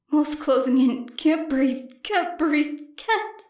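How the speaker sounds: a panic attack choking off the words; rising panic. A narrator's reading. Panic attack in nightmare memory.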